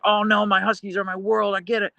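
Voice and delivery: high, gushing put-on sympathy voice; mock sympathetic chatter. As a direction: coo it like an overeager friend. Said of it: "It's like".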